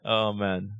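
ordinary talk

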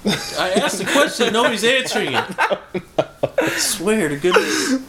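laughing. Real, not acted. Laughing complaint no one answers.

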